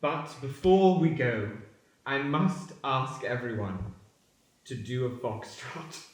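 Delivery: slow, grave and softening; solemn request. Acted. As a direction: announce it with mock gravity.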